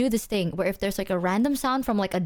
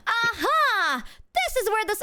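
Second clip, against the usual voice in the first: high, triumphant cat voice; a dumb cat sure it found the ghost; voicing the cat; 10 semitones higher than usual. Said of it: "he's like".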